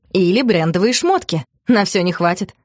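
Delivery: ordinary delivery, nothing marked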